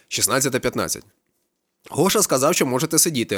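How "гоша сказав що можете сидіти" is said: high happy announcement; joyful. A narrator's reading. Kakao running into the kitchen, happy news. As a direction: burst in with good news. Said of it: "радісно вбігає на кухню Какао".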